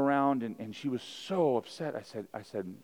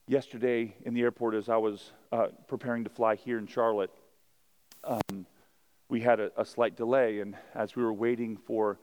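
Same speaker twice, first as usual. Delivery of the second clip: ordinary delivery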